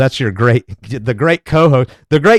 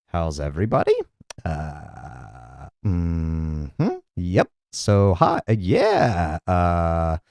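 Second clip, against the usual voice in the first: dull co-host impression; 10 semitones lower, 8 dB quieter, slower than usual. Imitating co-host with nothing to say.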